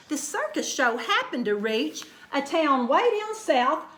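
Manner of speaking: ordinary delivery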